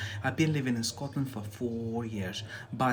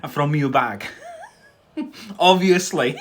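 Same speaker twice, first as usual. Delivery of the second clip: loud, higher, laughing through the words; 6 semitones higher, 8 dB louder than usual. Real, not acted. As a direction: let the laugh break into it. Laughing while he talks.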